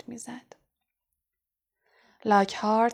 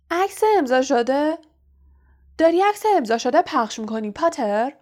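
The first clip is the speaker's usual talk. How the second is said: loud, higher mocking challenge; loud, unpleasant; 5 semitones higher, 5 dB louder than usual. A narrator's reading. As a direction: call out Potter with nasty disbelief. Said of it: "صدای بلند و ناخوشایند دریکو مالفوی". (با صدای بلند و ناخوشایند)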